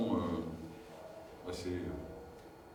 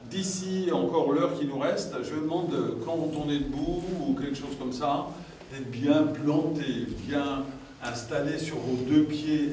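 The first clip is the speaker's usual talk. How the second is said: slow, higher and louder instructions, drawn out; grounding exercise guidance; 4 semitones higher, 5 dB louder, slower than usual. Acted. Trainer guiding the standing group to feel grounded, slow and measured. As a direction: plant them on their feet, one slow word at a time.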